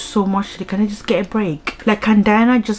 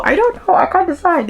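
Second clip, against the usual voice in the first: much higher, rising, squeaky I don't know; squealed indecision; 13 semitones higher, 4 dB louder than usual. Real, not acted. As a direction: let it climb into a helpless squeal. Torn over rating the book.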